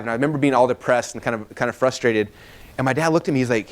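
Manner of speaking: ordinary talk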